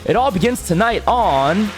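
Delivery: big game show promo voice; booming prize announcer. Acted. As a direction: sell it like a TV trailer.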